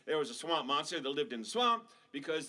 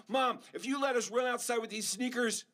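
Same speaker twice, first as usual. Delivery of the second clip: put-on bargaining kid; 7 semitones higher than usual. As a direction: offer chores like a pushy kid. Kids bargaining.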